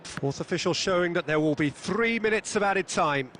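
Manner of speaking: normal talking voice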